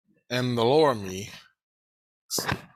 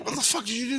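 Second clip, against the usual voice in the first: shocked; 11 semitones higher, much faster than usual. Retold or imitated. Shocked at his body.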